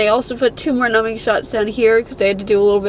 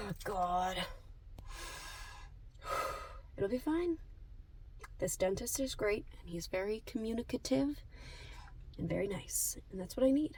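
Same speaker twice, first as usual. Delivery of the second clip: hushed; 3 semitones lower, 20 dB quieter than usual. Real, not acted. Hushed talk before dentist.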